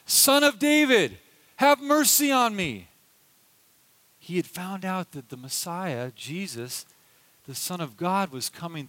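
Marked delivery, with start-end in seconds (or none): 0.1-2.9 raised pleading call, higher and louder; crying out. Retold or imitated. Blind beggar calling to passing Jesus, pleading cry. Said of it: "he hears this man crying out".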